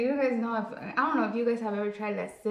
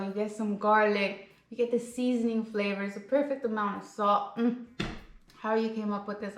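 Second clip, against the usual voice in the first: ordinary delivery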